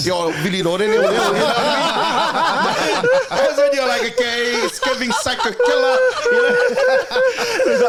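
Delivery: high, loud, laughing mock alarm; laughing high-pitched alarm at the killer. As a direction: squeal it out between laughs at the psycho killer. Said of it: "you gotta be like".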